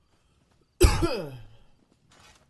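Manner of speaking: loud real coughing fit; hacking cough, throat irritated. Real, not acted. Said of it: "sorry I'm coughing so much".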